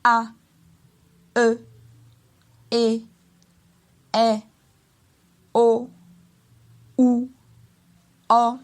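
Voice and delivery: each vowel "A, E, E, R, O, U, O" short and level; mid tone. Shown as a demonstration. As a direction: hold every isolated vowel on one steady pitch. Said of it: "ce ton moyen-là comme ceci".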